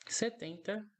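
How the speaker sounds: ordinary talk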